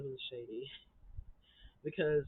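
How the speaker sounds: ordinary talk, nothing marked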